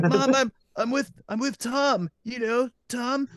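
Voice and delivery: high-pitched excuse to Mom; pretend kid. Prankster to fake mom, pleading as her kid.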